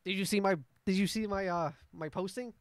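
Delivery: high, loud, tumbling over his words; a frantic merchant begging adventurers for help; desperate scraggly merchant. Acted. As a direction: blurt it out as he rushes up. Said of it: "run up to you and goes".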